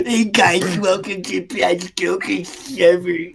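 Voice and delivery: lightly voiced slow mock intro, not far from his own voice; a slow, hammed-up copy of a friend's video greeting; impression of [name]. Redoing a friend's impression of him, slow and lightly voiced. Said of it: "that's his impression of me".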